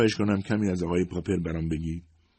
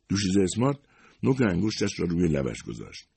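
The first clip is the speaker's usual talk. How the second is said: ordinary delivery, nothing marked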